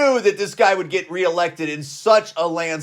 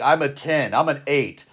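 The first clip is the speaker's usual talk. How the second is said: mock self-raters; 7 semitones lower, 4 dB quieter than usual. Mimics women rating themselves online.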